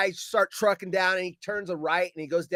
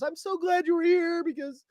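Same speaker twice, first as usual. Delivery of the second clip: high-pitched imitated woman, relieved; put-on higher voice of relief; relieved coworker; 11 semitones higher than usual. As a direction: gush it like the grateful coworker. Retold relieved coworker thanking him.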